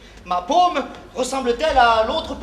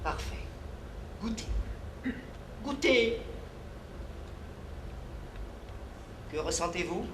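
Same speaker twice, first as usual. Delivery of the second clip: hushed guidance; 4 semitones lower, 13 dB quieter than usual. Acted. Guides tasting ritual.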